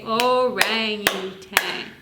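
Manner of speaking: slow clapped word. Shown as a demonstration. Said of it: "another good word would be orangutan".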